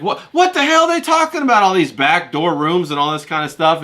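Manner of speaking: shocked disbelief, voice jumping up; baffled outburst. Retold or imitated. Baffled outburst about Discord rooms.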